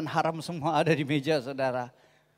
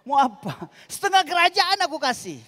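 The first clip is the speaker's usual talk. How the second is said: king offering half his kingdom, higher and louder; hammed-up generous king with his mouth full; king chewing on a bone; 9 semitones higher, 4 dB louder than usual. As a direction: chew the words like a king gnawing a bone. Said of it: "Sambil ngunyah-ngunyah tulang".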